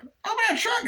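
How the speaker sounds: high-pitched order; actor impression. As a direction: order him to the trunk, cartoon style. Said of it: "doing the impressions of the, uh, actors".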